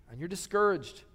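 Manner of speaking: ordinary delivery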